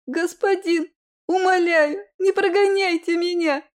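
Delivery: put-on high, loud wailing child's plea; wailing girl. A narrator's reading. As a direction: wail and beg like a small girl. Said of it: "Ещё пуще завыла она".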